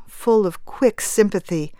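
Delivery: ordinary delivery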